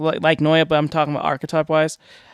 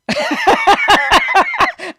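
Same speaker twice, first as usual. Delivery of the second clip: loud laugh; 9 dB louder than usual. Real, not acted. Laughing at own voice.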